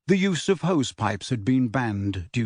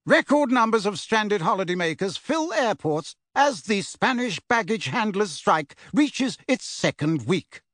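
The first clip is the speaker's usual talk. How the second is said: higher, crisp news read; newsreader; 7 semitones higher than usual. A narrator's reading. Newsreader on television, light news-read voice. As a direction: read it like a TV bulletin.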